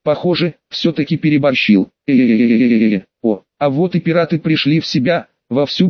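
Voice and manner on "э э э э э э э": long, low, steady "eeeh"; low droning hesitation noise; drawn-out drone. A narrator's reading. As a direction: hold one low vowel without a break. Narrator voices a long drone.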